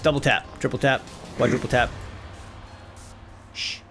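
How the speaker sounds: usual speaking voice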